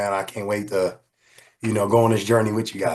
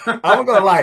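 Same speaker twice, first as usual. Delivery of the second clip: real laughter while answering, louder and higher; laughing; 8 semitones higher, 5 dB louder than usual. Real, not acted. Guest laughing as he answers his friend's teasing about the movie. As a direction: laugh your way through the answer.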